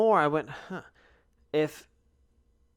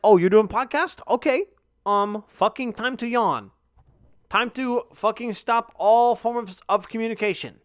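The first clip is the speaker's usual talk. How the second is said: mock body voice, higher and louder; voice of his body; 6 semitones higher, 5 dB louder than usual. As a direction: play your lazy body teasing you. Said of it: "my body's like".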